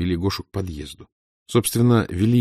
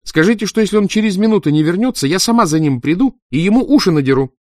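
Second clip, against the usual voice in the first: higher, louder, faster angry mother; irritated threat; 10 semitones higher, 6 dB louder than usual. A narrator's reading. Mother threatening over the phone to fetch her son, angry. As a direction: rattle off the threat, fed up. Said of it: "раздражённо заговорила женщина".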